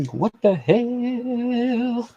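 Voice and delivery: drawn-out disbelief. Real, not acted. Streamer repeats her reaction to the score, very slowly and drawn out. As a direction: drag it out in mock disbelief.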